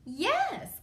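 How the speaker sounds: a touch of hammy dinosaur parent; lightly voiced dinosaur. A narrator's reading. Mama Dinosaur's lines, storytelling voice.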